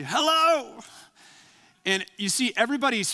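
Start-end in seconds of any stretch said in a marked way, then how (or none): 0.0-0.8 a boy's high, loud retold hello. Mother passes the phone to her son, loud high hello. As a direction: pop the greeting out high and bright like a small child. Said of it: "he says really loudly".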